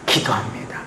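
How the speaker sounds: breathy hushed whisper. Acted. Preacher whispering that Hannah prays. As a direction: drop to a whisper.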